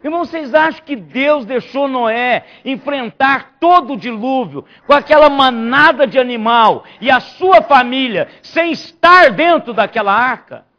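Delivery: loud, rising sermon shout; fiery preaching. Real, not acted. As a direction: drive the question home to the congregation. Preaches at full force.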